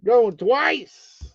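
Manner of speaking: high, drawn-out auction call; auctioneer call. Shown as a demonstration. Calling the bid to the stream like an auctioneer. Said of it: "where we at".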